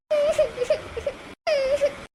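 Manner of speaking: a choked, wet sob breaking out; sobbing cry. Acted. Shreya sobbing to her sisters-in-law after being sent home. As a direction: sob as if just thrown out of the house. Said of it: "કેમ રડો છો?".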